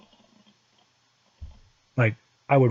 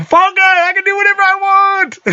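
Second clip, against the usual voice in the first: much higher, louder triumphant yell; 4 dB louder than usual. Acted. Retired at thirty-seven boasting to listeners, full-throated yell. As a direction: shout your freedom to the rooftops. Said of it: "I'm yelling at the top of my lungs".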